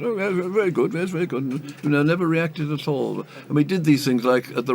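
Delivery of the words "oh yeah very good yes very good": high, breezy little voice of a chap who noticed nothing; unbothered diner. Retold or imitated. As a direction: toss it off lightly, pitched well up. Unbothered diner praising his fish.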